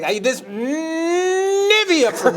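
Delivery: fed-up panelist. Retold or imitated. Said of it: "he had that look on his face".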